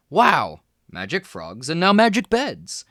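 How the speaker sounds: put-on a kid who can't believe it; amazed. A narrator's reading. Boys amazed at magic beds.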